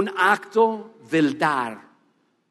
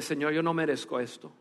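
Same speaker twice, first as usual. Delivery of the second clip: tearful; 4 semitones lower, 8 dB quieter than usual. Retold or imitated.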